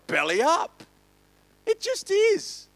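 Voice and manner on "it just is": blunt verdict. Real, not acted. World economy going belly up.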